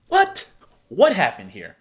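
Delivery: stunned reaction to a rude text; shocked. Real, not acted. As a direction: blurt out the shock.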